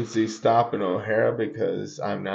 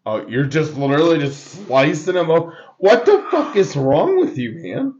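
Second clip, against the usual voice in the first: loud, high disbelief; outraged and amazed; shocked disbelief; 5 semitones higher, 4 dB louder than usual. Real, not acted. Friend shocked at casual surgery.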